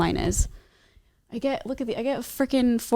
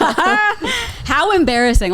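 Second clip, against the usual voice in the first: drawn-out laughing "how embarrassing"; 8 semitones higher, much slower than usual. Real, not acted. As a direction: laugh through it, slow. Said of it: "I would be so embarrassed".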